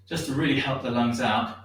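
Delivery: ordinary talk, nothing marked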